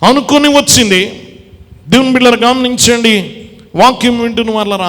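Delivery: loud, ringing preacher's call, slowing on the last words; preaching at full force. Acted. Preacher calling out loudly to the congregation about the sick woman.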